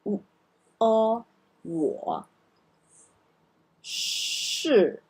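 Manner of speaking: 我 and 是 said one by one; 我, 我, 是 drilled slowly; syllable drill. Shown as a demonstration. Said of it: "Let's do the pinyin together".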